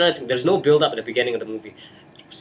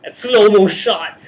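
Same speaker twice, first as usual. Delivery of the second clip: slow, loud, higher drawn-out words; 7 semitones higher, 8 dB louder, slower than usual. Retold or imitated. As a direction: stretch it out like slow motion. Mocking slow-motion movie shot.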